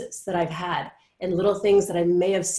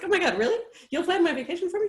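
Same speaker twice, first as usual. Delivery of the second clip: delighted; 11 semitones higher, faster than usual. Retold or imitated. Delighted reply to friend's offer.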